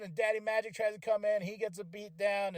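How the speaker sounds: ordinary talk, nothing marked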